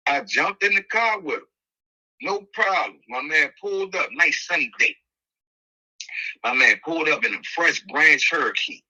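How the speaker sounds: ordinary talk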